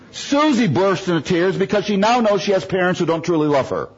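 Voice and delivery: ordinary talk, nothing marked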